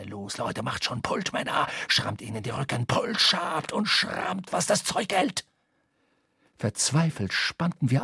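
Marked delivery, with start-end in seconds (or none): 0.0-5.4 whispered fury. A narrator's reading. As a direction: drive the crew on in a sharp whisper. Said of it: "flüsterte er scharf".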